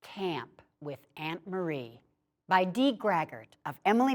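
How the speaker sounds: plain reading voice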